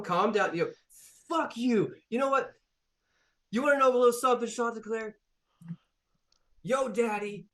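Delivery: high-pitched robot ranting, then threatening to beat him; furious rant. Acted. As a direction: build into a threatening rage. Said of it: "this face goes".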